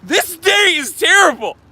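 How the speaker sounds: high, loud, hammed-up wail; mock outraged shout. Streamer joking that a fallen leaf ruined everything, mock outraged shout. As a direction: shout it in pretend despair.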